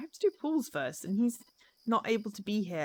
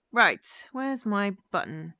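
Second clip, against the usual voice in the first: ordinary talk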